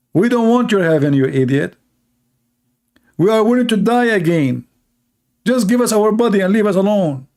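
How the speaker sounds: impatient outburst; fed-up demand. Retold or imitated. Martyrs demanding their bodies back, exasperated. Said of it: "they are asking Allah".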